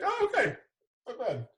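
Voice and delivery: high, squeaky impressed "oh, okay"; mock impressed, then cool it down; pleasantly surprised. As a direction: sound caught off guard by how she looks. Seeing the announcer lady.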